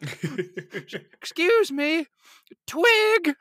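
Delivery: high, loud, slow call across the store; hammed-up old lady calling for help, laughing; old woman calling out. Retelling old shopper calling a clerk, high loud call. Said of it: "This old woman was like".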